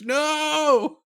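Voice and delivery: high piercing shriek. Acted. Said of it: "Ember Prime shrieks".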